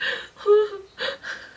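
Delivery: high mock sob; wordless imitation of hard crying; imitated crying. Storyteller showing how hard she cried as a child, mock sobbing. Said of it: "I s-, cried so hard".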